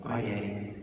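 tiny, hushed farewell to her father; small girl's goodbye. A narrator's reading. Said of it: "Smallest girl".

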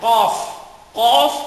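heavy Arabic qaf; thick Arabic qaf. Shown as a demonstration. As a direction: knock the Kof from the back. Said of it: "makapal yan".

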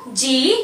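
जी said "jee"; जी with a long "ee", not "jay"; French letter J. Shown as a demonstration. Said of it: "pronounce किया जाता है".

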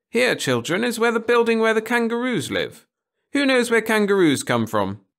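high, loud teacher voice; schoolteacher to class. Acted. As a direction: call the class together on a trip. Teacher addressing class at zoo.

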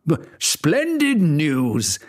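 king covers his panic before Griselda; flustered king. Acted. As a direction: force out a brave welcome. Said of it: "said the king".

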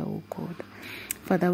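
usual speaking voice

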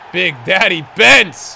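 loud slow hype shout; hype call. Real, not acted. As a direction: announce him like a hero. Hyped player call.

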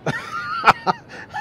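high-pitched burst of laughter; laughing. Real, not acted. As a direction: shriek with laughter. Laughing at his own joke.

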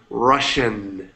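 slow "Russian" with a drawn-out sh; teacher modelling "Russian" with sh; careful pronunciation. Shown as a demonstration. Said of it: "es más S H".

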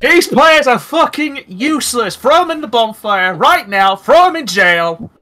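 high, loud, slow mock fury at players; mock rage. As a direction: rant at the useless team, hammed up. Raging at useless game players.

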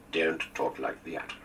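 slow, firm American drawl; firm, mock American accent. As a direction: firmly mimic an American accent while scolding. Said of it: "discouraged that with a firm".

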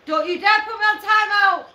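high, loud warning shout; indignant protest. Real, not acted. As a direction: protest loudly at being put on timeout. Threatened with timeout, protests.

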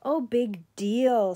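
slow, drawn-out bored brush-off; a yawning big deal. Acted. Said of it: "who gave a bored yawn".

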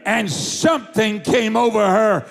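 high, building preaching voice; rising preaching climax. Acted. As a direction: swell it into the climax. Climax of the healing story.

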